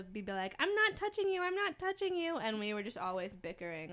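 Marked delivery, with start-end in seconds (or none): 0.6-2.4 high, singsong mock-kid teasing; imitated childish taunt, pitched way up; taunting sibling. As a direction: needle them like a bickering child. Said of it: "we would do that sibling thing".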